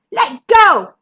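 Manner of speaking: loud, high, angry cry. A narrator's reading. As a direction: yell to make them let go. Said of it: "cried McMeer".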